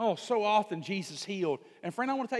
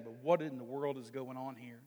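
ordinary delivery

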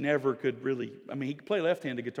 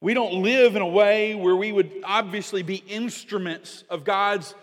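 louder, higher pulpit preaching; forceful preaching; 6 semitones higher, 8 dB louder than usual. Acted. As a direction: drive the point home to the church. Preaching builds again.

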